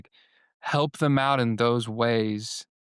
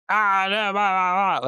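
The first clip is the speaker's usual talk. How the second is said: high, raised-voice mock babble; mock talkback yammering; 8 semitones higher, 4 dB louder than usual. Talkback person barging into a sweet worship moment, mock loud yammering. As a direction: blurt nonsense over the moment, exaggerated and high. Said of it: "not like".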